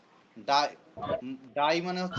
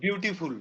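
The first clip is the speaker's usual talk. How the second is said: "Beautiful" run together in a single go; the whole "beautiful" in one effort, no breaks; one syllable. Shown as a demonstration. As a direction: say "beautiful" as one unbroken chunk. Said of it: "একটা".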